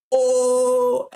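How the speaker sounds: a high laughing "oh" into a chuckle. Real, not acted. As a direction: laugh at your own joke. Laughing at his own joke.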